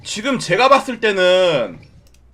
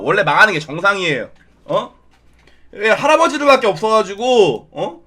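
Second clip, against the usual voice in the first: ordinary talk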